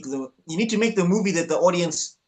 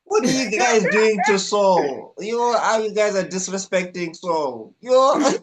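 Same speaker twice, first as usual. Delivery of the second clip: high, loud and slow outrage; mock outrage; 5 semitones higher, 4 dB louder, slower than usual. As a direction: cry out at the flop in fake dismay. Outraged on behalf of the actor.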